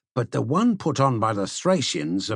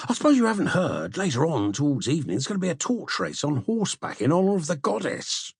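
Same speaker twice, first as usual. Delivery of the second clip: put-on fast, higher excited young man; eager young Adimantus; 5 semitones higher, faster than usual. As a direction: rush out the exciting news. Adimantus eagerly tells news.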